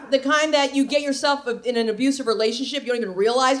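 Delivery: usual speaking voice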